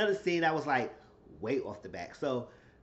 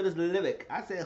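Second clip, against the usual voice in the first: ordinary delivery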